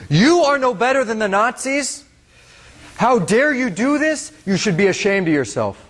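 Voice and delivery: high-pitched imitation of a shaming accuser; retold outrage, pitched well up; outraged accusation. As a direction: replay the man's indignant scolding. Man shoves camcorder, accuses soldier.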